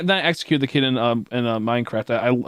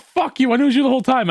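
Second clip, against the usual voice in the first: much higher, louder triumphant reveal; gloating shout; 12 semitones higher, 6 dB louder, faster than usual. Retold or imitated. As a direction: shout the betrayal as the trap closes. Final betrayal taunt.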